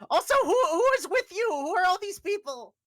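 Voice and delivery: high, loud, fast NPC voice; character voice. Acted. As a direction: greet them in a high excited voice. GM voicing character greeting party.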